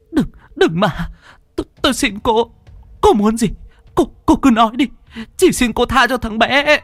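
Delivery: mother begging the ghost to spare her son; desperate pleading. Acted. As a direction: plead in panic for the boy.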